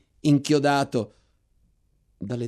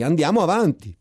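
"Andiamo avanti!" thrown out higher and loud, stress on "andiamo"; repeated as a shout; 7 semitones higher, 7 dB louder than usual. A narrator's reading. Narrator shouting back to the lieutenant to advance, loud and urgent. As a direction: answer the lieutenant with a loud urging call. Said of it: "E io gli ripetei".

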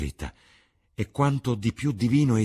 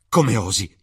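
louder, hurt and accusing; indignant outburst; 3 semitones higher, 8 dB louder than usual. A narrator's reading. Dorian lashing out at Harry over the news, indignant. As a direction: throw it back at him in disbelief.

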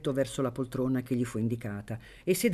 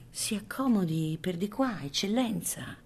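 gentle usher; 5 semitones higher than usual. A narrator's reading.

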